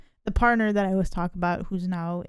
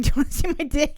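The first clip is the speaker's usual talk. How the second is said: imitating a friend; 6 semitones higher, 3 dB louder, much faster than usual. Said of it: "and you're like".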